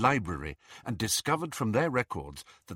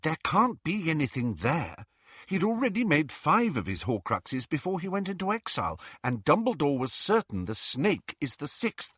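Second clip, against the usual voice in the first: higher-pitched, reasoning know-it-all voice; insistent, logical objection; earnest Hermione; 6 semitones higher than usual. Acted. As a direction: argue the point in a higher character voice.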